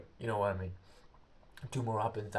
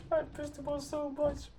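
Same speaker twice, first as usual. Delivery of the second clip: laughing. Real, not acted. Laughing at the irritated sergeant.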